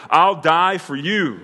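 loud, slow declaration of loyalty; bold, arrogant Peter. Acted. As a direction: proclaim it high, loud and drawn out. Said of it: "he is arrogant about his personal assessment".

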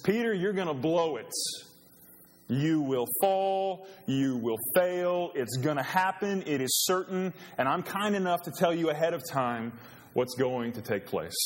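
Jesus warning Peter plainly and firmly. Acted. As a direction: spell out the coming failure to him. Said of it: "here he says".

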